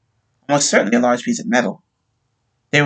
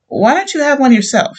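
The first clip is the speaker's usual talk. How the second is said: widow's offer; 13 semitones higher, 5 dB louder than usual. A narrator's reading. Widow offers sergeant a drink.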